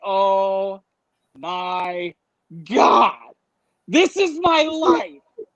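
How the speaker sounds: mock despair. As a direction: stretch each word, then wail the last phrase. Despair as cop car arrives.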